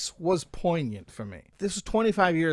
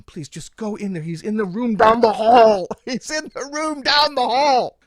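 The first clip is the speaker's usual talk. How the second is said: begging; 6 semitones higher, 12 dB louder than usual. Retold or imitated. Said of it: "So I begged him".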